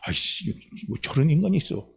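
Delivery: acted annoyance, kept soft; irritated grumble. Preacher imitates someone whose tract was thrown away, irritated grumble. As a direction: grumble under your breath, mildly put out. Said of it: "이러면서 막 성질나요".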